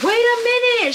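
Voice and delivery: sudden high startled wait a minute; surprised. Real, not acted. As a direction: jump up in pitch, caught off guard. Sudden surprised exclamation at package.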